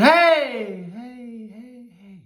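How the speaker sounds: echoing shout that dies away; calling out with echo. Acted. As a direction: call across the canyon and let it fade. Boy calling out, echo answers.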